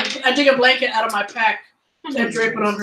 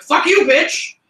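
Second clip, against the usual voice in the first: angry shout; 5 semitones higher, 5 dB louder, slower than usual. Retold or imitated. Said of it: "they're gonna be like".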